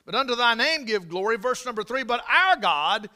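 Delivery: loud, high preaching climax; preaching at full force. Real, not acted. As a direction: thunder the verse at the congregation.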